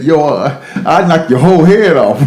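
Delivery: put-on light New York accent, tough threat; a hint of a New York mom threatening. Mother threatening the lady, in a light New York accent. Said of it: "here comes the New York accent".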